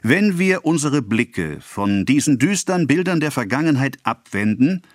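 a provincial councillor orating to the assembled farmers; declaiming official. A narrator's reading. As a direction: proclaim it like a speech to a crowd. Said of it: "fuhr der Redner fort".

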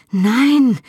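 woman gasping in pain, begging him to stop; gasped no. A narrator's reading. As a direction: cry out in pain and alarm. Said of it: "keuchte ich".

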